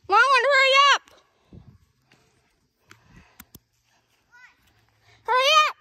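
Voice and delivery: high urgent yell; shouting. Real, not acted. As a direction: hurry them along at full voice. Urging friend to hurry.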